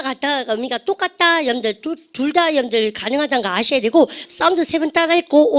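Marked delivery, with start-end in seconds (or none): none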